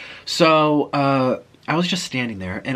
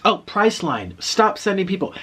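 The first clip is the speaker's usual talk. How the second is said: higher, mock-exasperated complaint; exasperated clerk; 8 semitones higher than usual. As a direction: imitate a clerk fed up with callers. Exasperated clerk answering another call.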